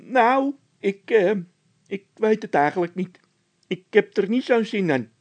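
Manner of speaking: high, loud, hesitant nervous voice; skittish Bol. A narrator's reading. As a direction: balk at going, jumpy. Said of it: "zei Bol schichtig". (Bol schichtig, hoog en aarzelend)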